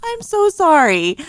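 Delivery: very high, squeezed-out words after a laughing fit; breathless from laughing. Real, not acted. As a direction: recover from helpless laughter. Apology straight after helpless laughing.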